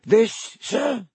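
high, eager elf squeak; house elf voice. Acted. Elf answering Harry, high squeaky voice. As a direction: pitch it up like a nervous house elf. Dobby voice.

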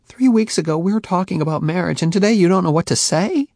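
ardent, hurt Faye. A narrator's reading. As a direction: press him hard. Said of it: "Her voice grew more ardent".

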